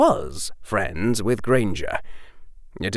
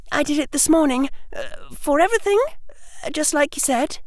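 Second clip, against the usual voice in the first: put-on very high girl's voice; high, hesitant schoolgirl; Hermione, a little hesitant; much faster than usual. Hermione telling Harry what she did, hesitant.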